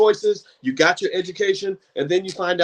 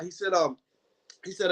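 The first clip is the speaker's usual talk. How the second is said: ordinary delivery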